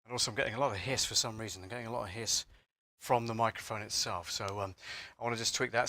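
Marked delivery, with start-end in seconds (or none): none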